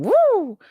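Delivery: high, loud siren-like whoop; imitated alarm woo. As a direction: wail a quick woo like an alarm. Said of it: "I was going".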